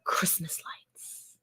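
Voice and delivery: soft unvoiced whisper; announcing a YouTube title in a hushed whisper. Real, not acted. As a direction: breathe it out as a secret title.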